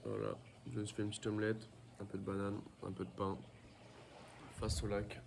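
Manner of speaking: talking between bites of food; quiet words round a mouthful; mouth full. Real, not acted. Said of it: "je suis en train de déjeuner".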